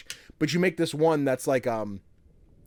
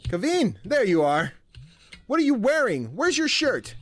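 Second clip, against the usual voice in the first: much higher scolding mom; 7 semitones higher than usual. Acted. Mother scolding her son about his cape, high and sharp.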